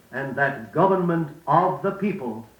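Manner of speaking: "government" stressed, falling tune; weight on "government", then fall. Shown as a demonstration. Modelling stress on "government" in Lincoln's closing line. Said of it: "notice what words are naturally stressed".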